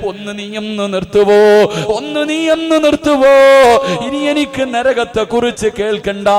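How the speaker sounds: loud chanted lament with long wailing endings; imitated tearful plea. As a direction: plead in a preacher's stylised cry. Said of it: "കരഞ്ഞുകൊണ്ട് പറയുന്നു". (കരച്ചിൽ അനുകരിച്ച് ഈണത്തിലുള്ള അപേക്ഷ)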